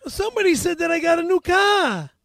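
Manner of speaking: put-on high, loud Boston accent. Claiming he got a car, Boston accent. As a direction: call it out in put-on Boston speech. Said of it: "You do an amazing Boston accent".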